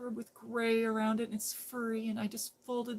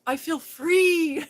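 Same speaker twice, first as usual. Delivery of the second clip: high, loud and slow, stretched out; joyful cry of freedom; gleeful; 8 semitones higher, 10 dB louder, much slower than usual. Retold or imitated. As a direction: shout it like a giddy runaway. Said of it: "He's running around Walmart going".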